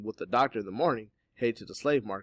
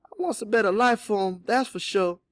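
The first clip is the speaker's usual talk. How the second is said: high, pleading mother's voice; louder, distressed declaration; anguished; 12 semitones higher, 9 dB louder than usual. A narrator's reading. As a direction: plead with raised pitch and some anguish. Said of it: "could almost touch the anguish in her voice".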